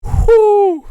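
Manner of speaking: shrill whoop; mock whoop. Mimicking a guy at the urinal, a shrill whoop. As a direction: let out a high loud whoo. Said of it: "that guy at the urinal".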